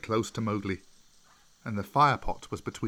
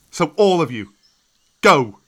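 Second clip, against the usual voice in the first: much louder, a rising shout to the final go; commanding shout; 5 semitones higher, 13 dB louder than usual. A narrator's reading. Mowgli ordering the wolves away, a loud commanding shout. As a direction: drive them off with a loud order.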